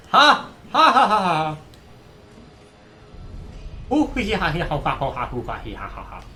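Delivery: loud, high cackling laugh; maniacal laughter. Real, not acted. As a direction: cackle like a greedy goblin. Gloating victory laughter.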